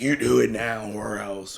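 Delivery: slow, put-on menacing order; mock threat. Threatening a friend who slips up, mock threat. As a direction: play the mean enforcer. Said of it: "I'm not gonna be like".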